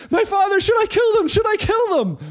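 high, loud, eager shout; a king desperate to strike, yelling. Acted. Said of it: "he shouts to Elisha".

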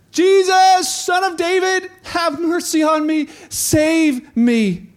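high, slow, drawn-out cry. Acted. Blind beggar shouts over crowd for mercy, shouting. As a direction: plead at the top of your voice. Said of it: "but he shouted louder".

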